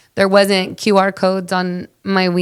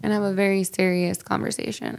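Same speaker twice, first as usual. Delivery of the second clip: normal talking voice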